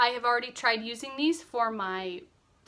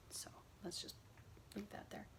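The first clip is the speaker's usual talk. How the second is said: whispering; 3 semitones lower, 21 dB quieter, faster than usual. Real, not acted. Whispered aside, setting it down.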